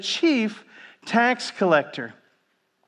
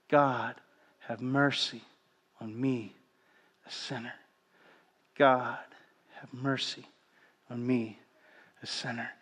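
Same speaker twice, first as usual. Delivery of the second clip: breath prayer; 5 semitones lower, 9 dB quieter than usual. Shown as a demonstration. Preacher demonstrating the ancient breath prayer softly. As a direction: breathe it out like a heartbeat. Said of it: "the breath prayer".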